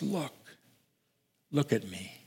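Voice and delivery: very soft, almost breathed plea; soft gentle call. Acted. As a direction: draw their eyes gently to you. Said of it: "will say to us".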